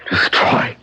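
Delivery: first word whispered, then low and dragging; a man muttering himself toward violence; whispered obsession. Acted. Alone before the wax exhibit.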